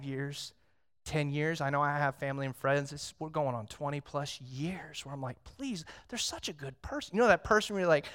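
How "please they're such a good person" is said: soft, high pleading prayer; a soft plea for a loved one. Retold or imitated. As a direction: beg the Lord quietly for them.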